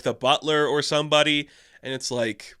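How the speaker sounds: ordinary talk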